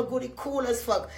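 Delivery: ordinary delivery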